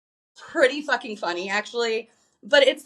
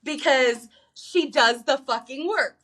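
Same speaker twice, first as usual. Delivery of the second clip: ordinary delivery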